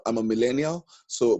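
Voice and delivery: ordinary talk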